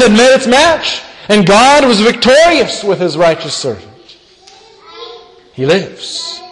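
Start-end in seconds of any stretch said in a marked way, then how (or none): 5.5-6.4 softly rising declaration of wonder; quiet proclamation. Acted. Preacher marvels quietly that the risen Lord lives, rising tone. Triumphant resurrection proclamation, first time.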